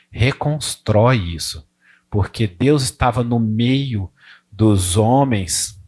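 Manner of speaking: ordinary delivery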